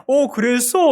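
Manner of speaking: put-on high, loud baby-talk reply; mother echoing her baby. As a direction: chime in big, as a mom to her infant. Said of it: "이렇게 또 맞장구를 쳐요".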